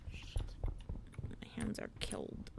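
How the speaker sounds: slow breathy whisper, very soft. Shown as a demonstration. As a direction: whisper it slowly to chat. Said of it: "repeated as whisper".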